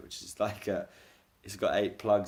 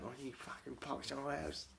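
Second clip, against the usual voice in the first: high, quiet imitation of a swearing neighbour; small, high mock tirade; mock angry rant; 6 semitones higher, 11 dB quieter than usual. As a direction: imitate irate griping at reduced volume. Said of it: "effing and balling at me".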